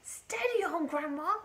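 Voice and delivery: high, loud voice of a worried grandson; alarmed boy. A narrator's reading. Boy tries to restrain grandma.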